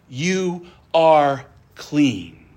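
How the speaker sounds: each word of "you are clean" drawn out slowly and weighted; slow emphatic. Real, not acted. Pastor assuring believers they are clean, slow and emphatic.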